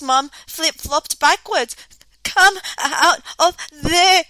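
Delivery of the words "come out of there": croaked. A narrator's reading. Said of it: "she croaked".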